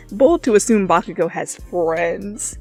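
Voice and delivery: ordinary delivery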